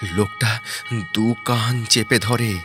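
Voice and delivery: usual speaking voice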